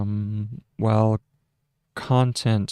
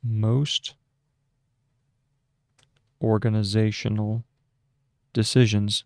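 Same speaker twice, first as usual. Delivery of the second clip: dictating. Shown as a demonstration. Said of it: "so that's period".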